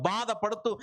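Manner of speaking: ordinary talk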